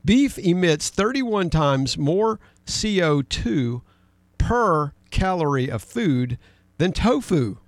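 slow, weighty read-out with pauses between phrases; deliberate quoting. Retold or imitated. Host quoting a magazine to his co-host, slow and pointed. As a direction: read it out slowly, leaning on each key word. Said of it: "here's the way, uh, they phrase it".

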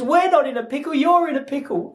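high-pitched imitation of an upset wife; mock rebuke. Upset wife blaming her husband, high mock rebuke. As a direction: throw the blame back in a high voice.

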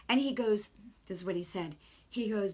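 ordinary delivery

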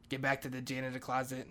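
ordinary delivery

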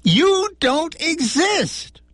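high, slow, exasperated. A narrator's reading. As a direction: spell it out in exasperation.

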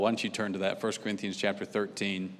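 normal talking voice

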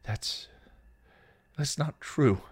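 halting, high-pitched denial; falter, then push it out; stammering. A narrator's reading. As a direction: stammer a hurt protest. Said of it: "Buffy stammered".

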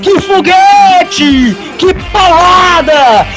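high, slow, stretched goal call; explosive goal commentary. Real, not acted. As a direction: savor every word of the goal. Goal replay, commentator still raving. (narração de gol empolgada)

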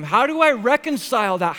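loud, high, frustrated demand; anguished outcry. Acted. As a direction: cry it out in exasperated pain. Same question, now an outcry.

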